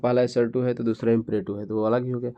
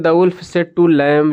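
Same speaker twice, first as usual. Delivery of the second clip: reading aloud; 5 semitones higher, 11 dB louder, much slower than usual. Shown as a demonstration. Teacher reads question aloud.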